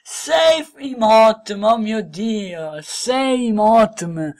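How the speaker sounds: loud, slow excited count; thrilled counting. Real, not acted. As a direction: savour each word in awe. Counts six Emotm players.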